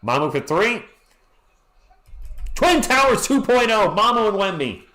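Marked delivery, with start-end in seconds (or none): 2.5-4.8 excited hype. Real, not acted. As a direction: announce it like a hype man. Hyping the duo.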